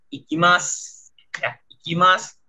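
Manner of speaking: "Ikimasu" as "ikimas"; silent u. Shown as a demonstration. Teacher models the reading, u dropped in "Ikimasu". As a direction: end "Ikimasu" on a hissed s.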